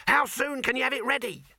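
high, eager shout; excited yell. A narrator's reading. Dibbler demanding the film be ready, high and excited. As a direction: fire off the question at high pitch. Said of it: "he yelled".

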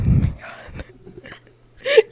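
breathy, slowed, high whisper of annoyance; storyteller interrupted again, whispering in exasperation; exasperated whisper. Real, not acted. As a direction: sigh it out, fed up. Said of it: "exasperated whisper".

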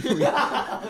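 squealing laugh; high laugh. Real, not acted. Big laugh burst.

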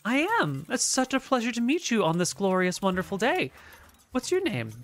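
high, sweet, shy little gnome voice; gentle gnome shopkeeper. Acted. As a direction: play a tiny gentle gnome, delighted.

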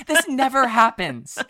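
hammed-up disbelief; mock surprised skater. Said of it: "That's what they're saying".

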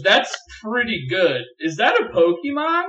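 lightly voiced wife admiring her own joke; pleased with herself. Wife praising her own Arbok cry, lightly voiced. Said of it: "And she goes".

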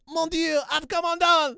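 put-on very high, loud French-accented wail; hammed-up French hero crying out in despair; appalling French accent. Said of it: "my French accent is appalling".

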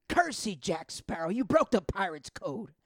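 big, loud, drawn-out movie shout; hammed-up pirate outrage. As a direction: bellow an accusation like a pirate in a film. Mimics a shouted film scene.

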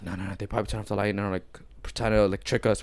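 very soft, low hushed reply; retold whisper. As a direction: keep it down as if hiding from staff. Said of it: "we were all whispering".

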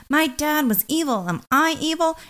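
high-pitched mock fourteen-year-old; mock teenager. Host mocking a character's teenage-style identity crisis, mock teenager. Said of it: "like a fourteen-year-old would go on".

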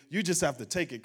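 ordinary talk, nothing marked

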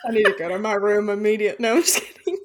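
loud, giddy laughing joke; laughing outburst. Real, not acted. Cohost jokingly swooning over a crush, laughing loudly. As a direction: blurt it out through real laughter.

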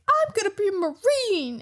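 high, exclaimed vow; mock resolve. Colby vowing to Luffy he will join up, high mock-determined cry. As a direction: proclaim it high, as a hammed-up hero.

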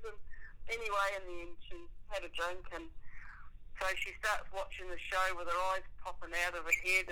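ordinary talk

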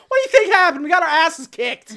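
sudden loud, high mock rant; exasperated outburst. Alien snapping at H's question, loudly exasperated. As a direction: explode after the casual setup.

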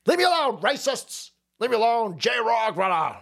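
high, hammed-up outburst; mock protest. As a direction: start high and indignant, easing down toward the end. Mock heckling, acted outburst.

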